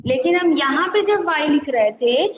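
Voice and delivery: ordinary delivery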